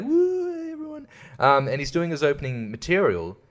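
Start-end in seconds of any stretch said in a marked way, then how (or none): 0.0-1.1 squealed high "Woo" then fading off; much higher mock comic greeting; mock hype opener. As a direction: imitate a limp stage entrance. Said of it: "he's like".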